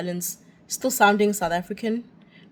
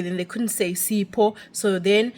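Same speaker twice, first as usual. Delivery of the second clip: ordinary talk, nothing marked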